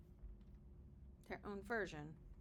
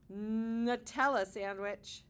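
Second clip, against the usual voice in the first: plain reading voice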